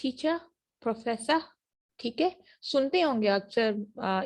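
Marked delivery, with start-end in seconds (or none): 0.0-0.4 "टीचर" as "teach-uh", pitched up; silent R. Shown as a demonstration. Teacher recapping for class, "टीचर" with silent final R. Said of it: "किस तरह से बोला जा". 0.8-1.4 "प्रोफेसर" ending "-fessuh"; silent R. Shown as a demonstration. Teacher recapping for class, "प्रोफेसर" with silent final R. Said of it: "किस तरह से बोला जा".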